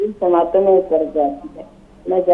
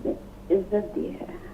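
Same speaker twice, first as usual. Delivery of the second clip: poem recitation. Acted. Recites a ghazal.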